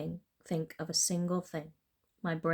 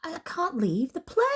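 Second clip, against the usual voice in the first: stammered; 11 semitones higher, 6 dB louder than usual. A narrator's reading. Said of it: "I stammered".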